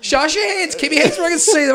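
high, loud, fast commands; barking orders. Retold or imitated. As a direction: order the family to show their hands. Acted armed standoff.

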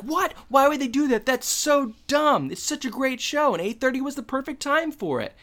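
outraged at the time change; shocked disbelief. Retold or imitated. Corey outraged about schedule change.

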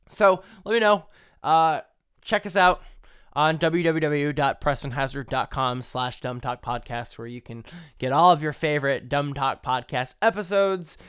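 podcast outro. Acted. Podcast sign-off plug.

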